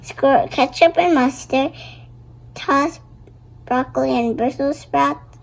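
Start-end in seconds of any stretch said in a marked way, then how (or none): none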